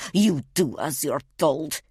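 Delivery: intimidating, controlled menace; menacing hiss. A narrator's reading. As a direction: threaten through a hiss, weighting the last words. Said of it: "he hisses".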